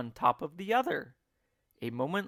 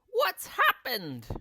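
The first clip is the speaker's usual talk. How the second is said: much higher, slow frightened cry; screamed; 11 semitones higher, 6 dB louder than usual. A narrator's reading. Missus Twit screaming at the upside-down room. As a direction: scream in alarm. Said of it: "screamed Missus Twit".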